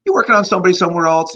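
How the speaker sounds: high, fast, loud curious ask; curious question. Retold or imitated. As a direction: fire it off out of curiosity. Quizzing a man at the seminar.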